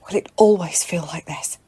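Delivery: shaken breathy whisper to herself; barely voiced, sick after the shot. Abyss whispering to herself after the killing, shaken.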